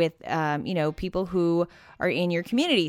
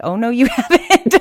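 louder, higher, laughing through the words; laughing retort; 4 semitones higher, 9 dB louder than usual. Retold or imitated. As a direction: tease him while cracking up. Teasing husband about his accent.

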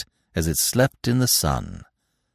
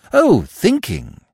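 impressed; 10 semitones higher, 5 dB louder, slower than usual. A narrator's reading. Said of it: "said Freddy, impressed".

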